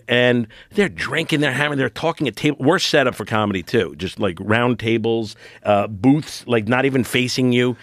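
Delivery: ordinary delivery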